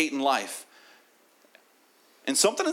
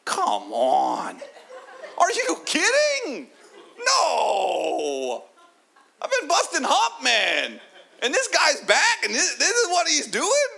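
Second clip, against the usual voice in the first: high, loud, half-laughing cry of disbelief; indignant whine, can't believe it; jealous brother ranting at the window; outraged older brother; 4 dB louder, slower than usual. Acted. Angry older son sees party.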